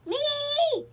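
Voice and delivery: loud, high screeching shriek; owl screech. A narrator's reading. Barn owl to Joe, screeching loudly. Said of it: "screeched that owl".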